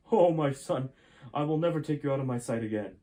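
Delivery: a touch of a father clutching his son; a hint of an emotional father. A narrator's reading. Gray embracing his son Jason, a hint of relieved emotion.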